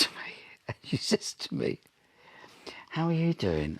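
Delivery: hushed, breathy whisper. Retold or imitated. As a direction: whisper it like a secret. Telling a hushed moment beside Diana.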